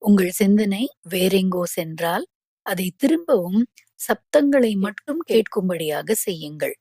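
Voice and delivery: usual speaking voice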